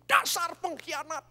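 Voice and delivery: high, loud mock insult; furious wife. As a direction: spit it out like the raging wife. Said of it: "ngamuk Ibu Yusuf".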